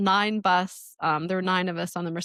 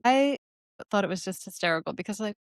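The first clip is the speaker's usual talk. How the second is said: usual speaking voice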